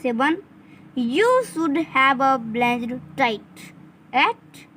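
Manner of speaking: slow, word by word for dictation. Shown as a demonstration. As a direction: read it out slowly so they can write. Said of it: "आप लिख लीजिए".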